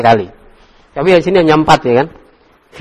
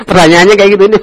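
higher, louder talk through laughter; amused, laughing out loud; 6 semitones higher, 7 dB louder, much faster than usual. Real, not acted. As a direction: say it while cracking up. Teacher laughing at student's question intonation.

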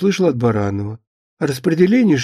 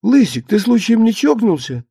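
high, incredulous wifely query; a touch of disbelief; surprised; 7 semitones higher than usual. A narrator's reading. Wife asking if husband has gone mad, surprised. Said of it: "удивилась Кукуша".

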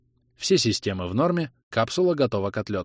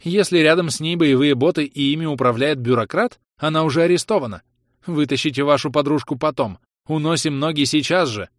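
urgent command; 6 semitones higher, 5 dB louder than usual. Acted. Urging escape.

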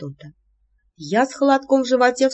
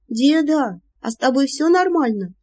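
frightened bleat; 6 semitones higher than usual. Acted. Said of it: "испуганно проблеяла я".

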